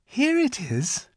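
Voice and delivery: put-on high, slow child voice; helpful little girl. A narrator's reading. Lucy hands the giant her handkerchief, helpful. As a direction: pipe it up helpfully, drawn out. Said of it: "said Lucy".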